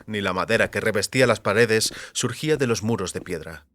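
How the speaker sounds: plain reading voice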